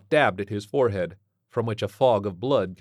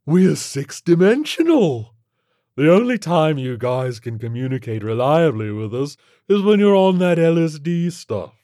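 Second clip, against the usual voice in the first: loud, higher alien voice; know-all alien creature; 7 semitones higher, 10 dB louder than usual. Acted. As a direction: explain grandly to lesser beings.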